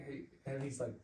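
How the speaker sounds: ordinary talk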